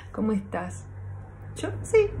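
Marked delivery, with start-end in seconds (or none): 1.6-2.1 much higher, strained little yes; squeaky forced yes. Acted. Sad woman fakes a cheerful yes to her friend.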